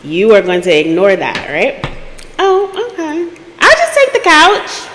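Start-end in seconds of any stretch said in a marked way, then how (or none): 2.4-4.9 high-pitched, acted agreeable voice; put-on high, accommodating tone; meek compliance.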